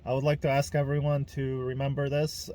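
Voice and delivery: ordinary delivery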